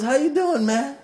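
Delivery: bright, high friendly hello to a stranger; cheerful greeting. Retold or imitated. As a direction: greet him warmly with a big smile in the voice. Teacher greets a mean-looking stranger.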